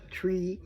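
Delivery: "tree" said like the plant, as a teaching syllable; pronunciation demo. Shown as a demonstration. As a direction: sound out "tree" as the first syllable. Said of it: "like a tree".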